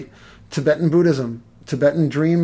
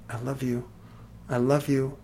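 soft pleading; 2 semitones lower, 9 dB quieter than usual. Retold or imitated. Retold sending love to dogs.

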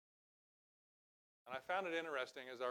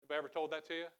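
ordinary delivery

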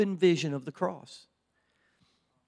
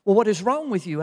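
louder, higher exasperated question; imitating dad; 6 semitones higher, 7 dB louder than usual. Dad presses his crying boy once more, imitated louder questioning. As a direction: press the child for an answer. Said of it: "And he said".

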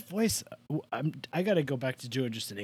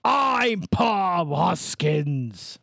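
loud, higher, hugely drawn-out caricature voice; Bob Hoskins; 4 semitones higher, 5 dB louder, much slower than usual. Shown as a demonstration. Guest testing his actor impression on co-host, slow bellow. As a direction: bellow it very slowly as a hammed-up impression. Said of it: "this is what Bob Hoskins sounds like".

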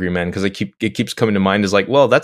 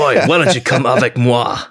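Cockney French mix; 2 semitones higher than usual. Pretend. Cockney-French Picard mashup.

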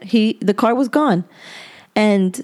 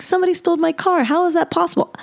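high, fast panicked cry; frantic, rushed disbelief; freaking out; 10 semitones higher, faster than usual. Retold or imitated. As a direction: panic that the car is gone. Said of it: "I start freaking out".

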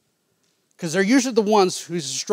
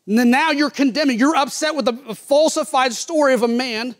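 fiery preaching; 10 semitones higher, 6 dB louder than usual. Acted. Preacher confronting David's sin.

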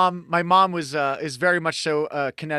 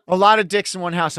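loud; 5 semitones higher, 8 dB louder than usual. Real, not acted. Repeating co-host's joke loudly.

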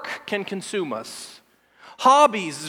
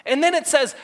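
ordinary talk

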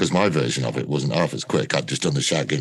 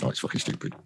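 under-the-breath whisper of frustration; whispered curse; 7 dB quieter, faster than usual. Retold or imitated. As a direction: hiss it as an aside nobody should hear. Arguing with the director.

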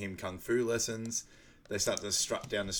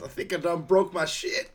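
high, loud comic quote; hammed-up imitation of a comedian's yelp; Chris Tucker; 9 semitones higher, 8 dB louder than usual. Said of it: "Chris Tucker just goes".

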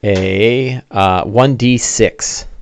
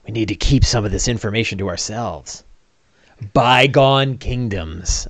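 much softer, secretive voice, slowing at the end; conspiratorial hush; 3 semitones lower, 9 dB quieter than usual. Acted. Dwarves guard a secret at the inn, conspiratorial hush. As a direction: keep it low between friends. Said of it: "keep quiet".

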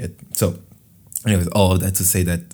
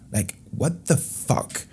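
exasperated; 6 semitones higher, 5 dB quieter, slower than usual. Real, not acted. Ranting about school math.

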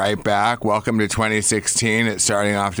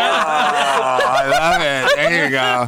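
laughing; 5 semitones higher, 4 dB louder, slower than usual. Real, not acted. Host laughing at the story.

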